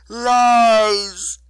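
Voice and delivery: huge, high, loud bellow; furious roar. A narrator's reading. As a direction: roar it as an enraged goddess. Goddess rejects the lulling words.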